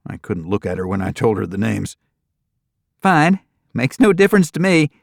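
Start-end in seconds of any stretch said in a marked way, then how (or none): 3.0-4.9 put-on much higher, brighter country girl clerk; cheerful young desk clerk greeting guests. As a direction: chirp it out like a perky receptionist.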